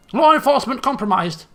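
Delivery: loud, high mock cop scream; hammed-up megaphone panic. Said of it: "she screams in the megaphone".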